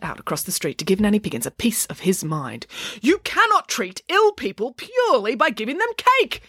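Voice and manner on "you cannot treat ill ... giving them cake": high-pitched, outraged yelling; indignant yell. A narrator's reading. Doctor yells at the cake seller, indignant. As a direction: yell it high, giving her a piece of your mind. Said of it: "he yelled at Nanny Piggins".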